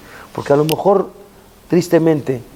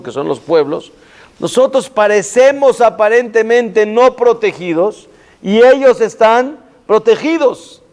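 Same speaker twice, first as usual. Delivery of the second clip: raised, higher pleading sermon voice; impassioned plea; 9 semitones higher, 3 dB louder than usual. Acted. Rabbi pleading with God before his congregation, impassioned. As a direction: cry out to God on the people's behalf.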